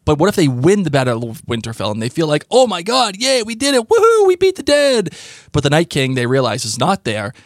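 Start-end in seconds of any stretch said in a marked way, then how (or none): 2.5-5.1 excited cheer. Pretend. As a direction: cheer like you just won. Imagined victory cheer.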